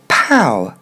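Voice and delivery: "pow" rhyming with "cow"; English first syllable. Shown as a demonstration. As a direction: say "pow" with the ow sound, falling. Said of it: "c'est le P O W".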